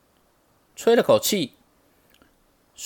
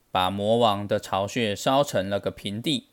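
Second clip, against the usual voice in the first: ordinary delivery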